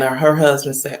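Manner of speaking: ordinary delivery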